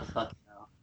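soft breathy swear under breath; muttered curse. Real, not acted. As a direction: grumble it to yourself. Intro ruined, muttered curse.

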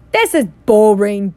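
loud bored moan. A narrator's reading. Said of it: "Dudley moaned".

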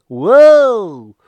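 loud high startled whoa; surprised whoa. Retold or imitated. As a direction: shout it out, startled. Mock surprised exclamation retold.

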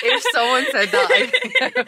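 high, laughing through the words. Real, not acted. Friend laughing at the mock American line. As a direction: talk while giggling.

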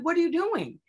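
high-pitched retold disbelief; exasperated, swooping question; incredulous outrage. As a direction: demand an explanation, pitch leaping up. Retold argument over emergency.

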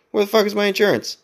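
mounting panic while searching the car; frantic retold yell. Frantic search for insurance card.

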